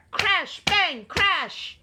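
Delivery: loud, high storm sound words; thunder crashes. A narrator's reading. Narrator voicing the storm, loud crashes. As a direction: punch out each crash of thunder.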